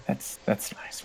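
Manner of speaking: very soft, nearly voiceless echo; muttered echo. Acted. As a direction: echo it under your breath. Second wizard echoes the first.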